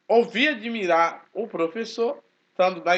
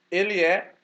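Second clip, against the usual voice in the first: usual speaking voice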